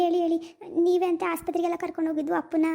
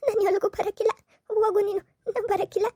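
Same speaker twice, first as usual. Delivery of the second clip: crying refusal; 3 semitones higher than usual. Acted. Daughter sobbing that she won't leave her father, crying. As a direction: refuse through tears, building.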